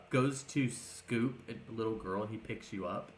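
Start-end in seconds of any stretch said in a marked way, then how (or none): none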